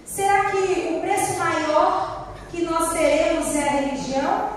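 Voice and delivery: usual speaking voice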